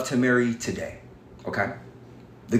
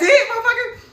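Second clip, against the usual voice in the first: high, laughing disbelief; incredulous laugh, real. Real, not acted. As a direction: laugh it out in scorn. Laughs at the peanut butter claim.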